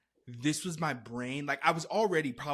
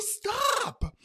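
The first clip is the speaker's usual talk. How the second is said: very high, loud, drawn-out shriek; imitated yelling; 5 dB louder than usual. Friend yelling at the jumping storyteller, shrieked. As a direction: screech the one word. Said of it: "her yelling also was doing something".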